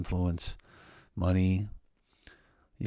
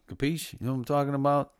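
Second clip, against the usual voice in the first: hammed-up Italian accent, lifting higher at the end; 7 semitones higher, 4 dB louder, faster than usual. Host asks listeners if they understand, in a playful Italian voice. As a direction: slip into a mock-Italian wise-guy patter. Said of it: "Italian voice this morning".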